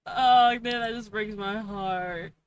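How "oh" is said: ordinary talk, nothing marked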